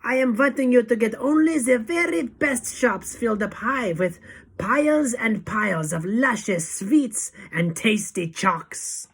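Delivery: high, loud demand, easing at the end; Grand High Witch shout. A narrator's reading. Witch leader demanding the best, loud shout. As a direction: shout it, relishing the list. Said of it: "shouted the Grand High Witch".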